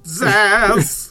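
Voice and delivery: loud zapping sound; zap sound effect. Pretend. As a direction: shout a laser zap. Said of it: "It was like".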